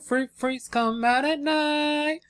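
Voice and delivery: playful spooky croon. Pretend. Repeats intro line, higher and slower.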